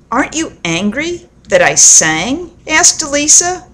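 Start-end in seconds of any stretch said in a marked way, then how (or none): none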